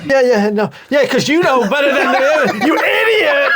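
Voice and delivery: high, rising to a laughing shout; building mock rage. Friend imitating Ben Stiller yelling, laughing. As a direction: blow up at the couple. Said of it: "He's like".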